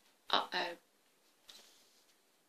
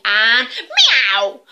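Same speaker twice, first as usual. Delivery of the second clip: pouncing cat yowl; 2 semitones higher, 13 dB louder than usual. Acted. Cat leaping at the mice, loud meow.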